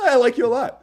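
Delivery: high mock-mom reassurance; flustered mother. Mother settling for liking her son, awkward. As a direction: pitch it up, falsely reassuring.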